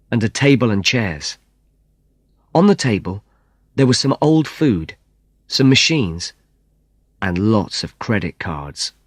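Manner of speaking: ordinary talk, nothing marked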